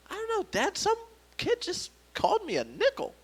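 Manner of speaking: high, soft, hesitant voice; confused young son. Retold or imitated. Son tells dad about the kid, high and baffled. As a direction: answer small and high, with pauses.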